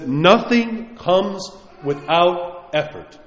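every word slow and heavy, Nothing leaned on; slow weighty quote. Retold or imitated. Priest quoting Saint Theophan to his flock, slow and weighty. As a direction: drop each word slowly like a verdict. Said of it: "He says".

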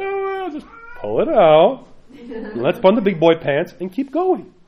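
mocking the one who says just pull it out; mock tough-guy voice. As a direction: play the macho shrug-off, pulling out a splinter.

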